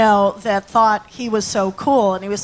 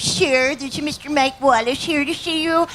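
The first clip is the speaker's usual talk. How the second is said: higher, prim telephone operator voice; Elaine May voice; 4 semitones higher than usual. Retold or imitated. Audition take for a Xerox ad, in the prim operator voice. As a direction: sing it out like a snooty switchboard girl. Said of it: "do my Elaine May voice".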